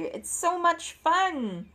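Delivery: plain reading voice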